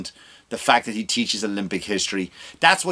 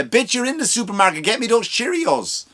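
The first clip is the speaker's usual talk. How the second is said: high exasperated snap back; high mock scolding of mother; exasperated retort; 9 semitones higher than usual. Son telling off mother on phone, high exasperated. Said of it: "when you call your mother up".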